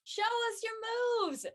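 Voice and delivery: high, loud, drawn-out cheer. Acted. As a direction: egg him on across the gym. Cheering Kellen from the court.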